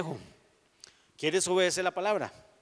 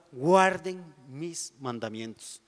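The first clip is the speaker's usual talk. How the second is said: slow emphasis; slower than usual. Shown as a demonstration.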